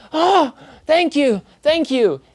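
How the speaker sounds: high, pleading gasps of thanks; desperate begging man. Acted. GM voices a crawling man, pleading thanks. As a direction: beg like a broken man on his knees.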